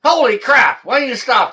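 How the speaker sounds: high, loud, delighted exclamation; surprised shout. Real, not acted. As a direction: burst out in happy surprise. Host surprised by caller Ed.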